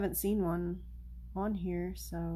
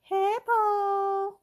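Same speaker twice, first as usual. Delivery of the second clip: much higher, louder greeting to the cat; high call to the cat; 12 semitones higher, 6 dB louder than usual. Real, not acted. Owner greeting Poe, high loud call. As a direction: sing out the cat's name high.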